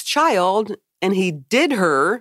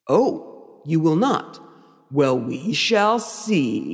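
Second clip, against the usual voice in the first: dramatic, drawn-out villain menace; hammy movie tempter; [name] Lucifer; 3 semitones lower, much slower than usual. Acted. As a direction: stretch the last words slowly like a scheming devil. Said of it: "This is very dramatic".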